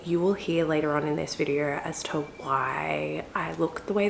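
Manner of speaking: soft, hushed voice so nobody wakes; talking quietly. Real, not acted. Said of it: "I'm talking really quietly".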